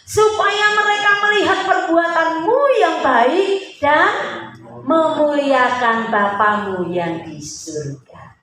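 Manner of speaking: slow, high and loud proclamation; scripture proclamation. Acted. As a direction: proclaim the verse loud and drawn out, settling at the end.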